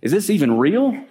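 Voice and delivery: dazed, can this be real; awed disbelief. Retold or imitated. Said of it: "I'm like".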